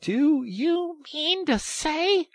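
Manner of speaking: very high, loud, slow disbelief; excited cry. A narrator's reading. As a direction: challenge a friend incredulously. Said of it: "cried the excited rat".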